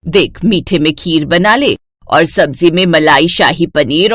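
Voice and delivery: low, bossy mother-in-law voice; a domineering saas giving orders; stern mother-in-law. Acted.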